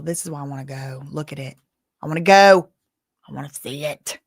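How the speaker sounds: usual speaking voice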